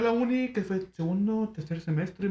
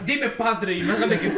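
slow, louder, laughing; mock quote laughing; 3 semitones higher, 6 dB louder than usual. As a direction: tease while laughing.

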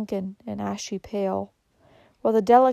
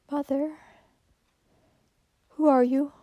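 weak, confused; 6 semitones higher than usual. A narrator's reading. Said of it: "in a weak voice".

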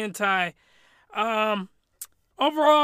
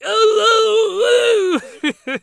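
much higher, loud mock howl; tormented wail; 13 semitones higher, 9 dB louder, much slower than usual. Podcaster mimicking a soul in purgatory, tormented wail. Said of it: "he was just in purgatory".

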